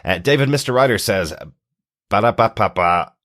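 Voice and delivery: usual speaking voice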